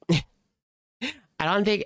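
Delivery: usual speaking voice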